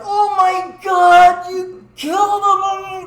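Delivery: high, very loud drawn-out wail; slow horrified mock scream; friend going nuts. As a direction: imitate a friend freaking out at full volume. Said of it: "Tim is going absolutely nuts".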